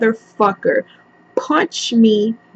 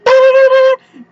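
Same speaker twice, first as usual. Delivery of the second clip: much higher, loud, fast, tearful shout; crying scolding mom; 12 semitones higher, 3 dB louder, faster than usual. Retold or imitated. Mother smacking her son, crying and shouting. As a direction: scream it while hitting him.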